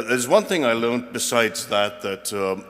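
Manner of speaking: ordinary talk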